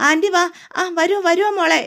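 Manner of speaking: aunt flusteredly urging the guest to come in; fussy welcome. A narrator's reading. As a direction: hurry the guest in eagerly. Aunt fussily ushering guest inside.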